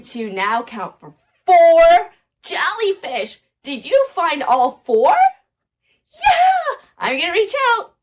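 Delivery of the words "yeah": high, bright shout of delight; a squeal of joy for the little one; excited cheer. Real, not acted. Cheering the child's find.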